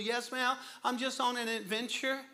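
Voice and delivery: put-on high, bright child answer; little girl answering the stranger politely, high; sweet little girl. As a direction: chirp it like a polite four-year-old. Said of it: "She goes".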